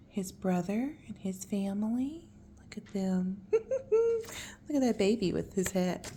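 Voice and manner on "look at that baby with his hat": laughing. Real, not acted. Laughing at picture.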